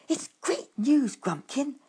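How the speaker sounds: excited mumble. Acted. Badger brings news from behind handkerchiefs, excited mumble. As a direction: bubble over with good news through a cloth. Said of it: "he mumbled excitedly".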